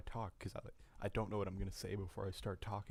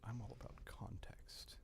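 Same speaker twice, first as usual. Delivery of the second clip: soft unvoiced whisper; 2 semitones higher, 9 dB quieter than usual. Real, not acted. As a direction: breathe it as a whisper. Whispered remark.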